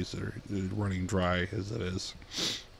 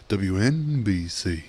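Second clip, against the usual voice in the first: slow, swooping station call sign; Howard Stern; 3 dB louder, slower than usual. Retold or imitated. Repeating a radio bit for laughs, exaggerated station ID. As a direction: stretch the letters with a big swoop. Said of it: "he was doing the".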